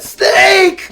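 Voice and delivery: high, loud panicked cry; panicked yell. Retold or imitated. Bitten boy crying out to his dad, high panicked shout. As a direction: scream it like the snake just bit you. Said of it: "I said".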